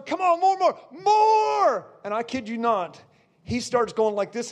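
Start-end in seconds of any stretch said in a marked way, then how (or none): 0.0-1.9 high, insistent revival cry; re-enacted fervent preacher's shout; urging shout. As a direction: urge him on, climbing to a long yell.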